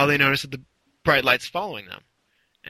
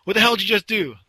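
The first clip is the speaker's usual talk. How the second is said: higher-pitched mock yell; retold cop yell; 7 semitones higher, 4 dB louder, faster than usual. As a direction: demand it like an imitated angry cop. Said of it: "Cop yells at him".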